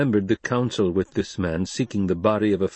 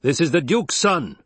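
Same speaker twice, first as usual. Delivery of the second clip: higher, firm bark, moderately loud; 7 semitones higher, 4 dB louder than usual. A narrator's reading. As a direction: bark it back at a challenger. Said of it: "Stilgar barked".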